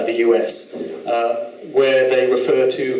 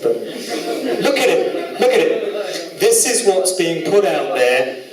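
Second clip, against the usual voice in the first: slow, higher, drawn-out look at it; exasperated; 5 semitones higher, slower than usual. Real, not acted. Host urging viewers to look, slow and exasperated. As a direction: stretch it out in disbelief. Outraged host shows lyric.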